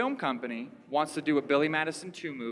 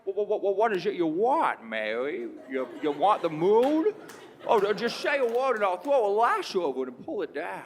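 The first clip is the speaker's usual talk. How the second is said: Jimmy Stewart; 4 semitones higher, 4 dB louder than usual. Acted. Film hero promising Mary the moon, hammed-up stammering impression. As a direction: stutter and stretch it, swelling to loud outbursts. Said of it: "he talked the same in every one of his movies".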